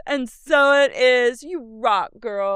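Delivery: high, slow mock affirmation; imitating mom. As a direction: echo the prayer like a cheesy mother. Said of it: "and Andrea goes".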